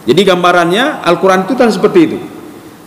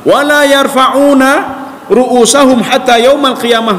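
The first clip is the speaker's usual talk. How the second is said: slow reverent recital in Arabic; Arabic recitation; 4 semitones higher, slower than usual. Acted. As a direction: intone the Arabic words slowly and solemnly. Arabic hadith phrase recited slowly.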